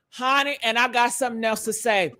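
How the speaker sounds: normal talking voice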